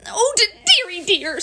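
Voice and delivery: high, loud little wail; high-pitched distressed stammer; dismayed Piglet. Acted. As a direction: fret in a high small-creature voice. Piglet upset over burst balloon.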